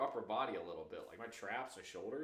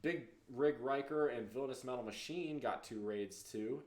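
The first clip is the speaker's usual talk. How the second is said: ordinary delivery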